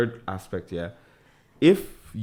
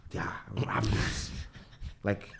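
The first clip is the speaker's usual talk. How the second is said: whispered; 9 dB quieter than usual. Real, not acted. Whispered joke aside.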